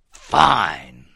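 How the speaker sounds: low, loud growl; growling anger. A narrator's reading. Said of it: "growled anger".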